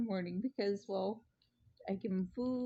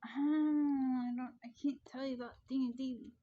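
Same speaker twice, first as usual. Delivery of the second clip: higher, half-crying mock wail that speeds up; mock whine; 5 semitones higher than usual. Telling viewers she can't share D and D, mock crying. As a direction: pretend to be heartbroken, hammed-up.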